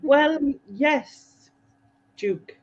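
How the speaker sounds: a hint of the Queen answering her husband; posh Queen voice. Friend showing how the Queen would really talk, light clipped royal impression. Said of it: "She would say".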